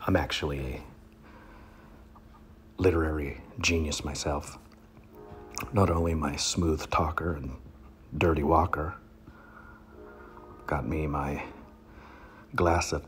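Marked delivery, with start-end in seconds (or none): none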